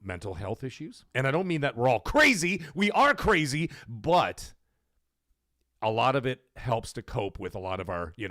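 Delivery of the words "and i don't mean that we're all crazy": ordinary talk, nothing marked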